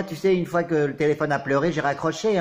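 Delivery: ordinary delivery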